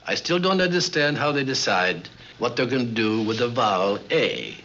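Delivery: ordinary talk, nothing marked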